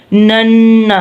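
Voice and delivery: "نن" with n held through the nose; ghunna. Shown as a demonstration. Teacher showing tashdeed, held nasal ghunna. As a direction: hum the doubled n of "نن". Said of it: "ناک میں سے آواز نکالنی ہے".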